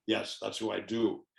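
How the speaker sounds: usual speaking voice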